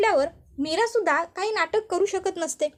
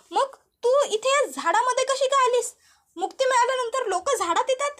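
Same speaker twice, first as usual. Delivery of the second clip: higher, amazed and eager questions; joyful surprise; 6 semitones higher than usual. A narrator's reading. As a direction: she can't believe her sister is here. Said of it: "अंबिकाला बघून मीराला खूप आनंद होतो".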